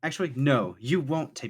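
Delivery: put-on girl asserting herself. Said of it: "turns back around and says".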